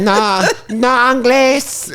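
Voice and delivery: put-on high, slow Eastern European accent; comic pretending not to speak English to a stranger, Eastern European accent. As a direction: stretch it out as a fake Eastern European. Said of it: "you go Eastern European".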